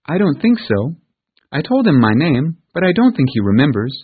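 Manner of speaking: put-on a child answering carefully; unsure little girl. Pollyanna answers aunt.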